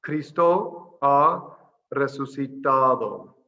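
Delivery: Cristo ha resucitado spelled out syllable by syllable, very slow and loud; slow Spanish demo. Shown as a demonstration. Teacher models the Easter greeting for the class, slow careful Spanish. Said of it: "the first part".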